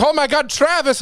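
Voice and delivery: high, delighted "Oh my God, Travis"; excited recognition. Retold or imitated. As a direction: pitch way up in happy surprise. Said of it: "And he was like".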